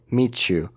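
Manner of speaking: "Meet you" blended as "meechu"; ch sound. Shown as a demonstration. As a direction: turn t plus y in "Meet you" into ch. («Meet you» با صدای چ)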